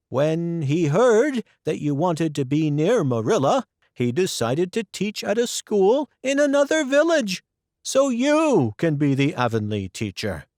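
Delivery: a busy neighbour bringing news; Rachel Lynde's voice. Acted.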